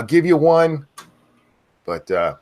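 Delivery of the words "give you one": louder, higher second go; finally granting the viewer a shout; name yell given. Real, not acted. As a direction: give them the yell. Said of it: "I'll give you one".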